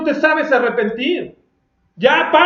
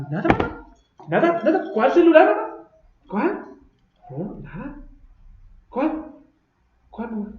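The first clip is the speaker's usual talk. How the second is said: put-on high, innocent evasive child voice; evasive kid; 5 semitones higher than usual. Child dodges mother's question in a high innocent voice. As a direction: play a kid dodging mom's question.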